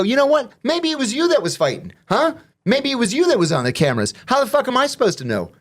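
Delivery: high, indignant retold comeback; mock indignation. Inmate turning blame on the lieutenant, mock indignation. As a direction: throw the accusation back, pitched right up.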